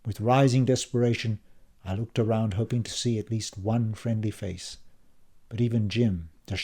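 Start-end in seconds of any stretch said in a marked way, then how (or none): none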